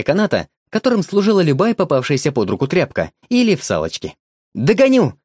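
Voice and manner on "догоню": high loud playful cry; playful shout. A narrator's reading. Boy chases the dog in play, playful shout. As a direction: shout it while chasing. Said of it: "кричал мальчик".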